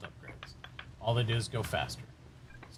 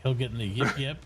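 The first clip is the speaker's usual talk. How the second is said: ordinary delivery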